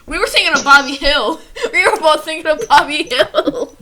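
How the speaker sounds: loud laughing speech. Real, not acted. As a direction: laugh through it. Laughing fit.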